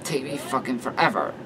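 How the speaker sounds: plain reading voice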